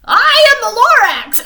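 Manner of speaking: Lorax voice. Acted. Lorax introduces himself loudly.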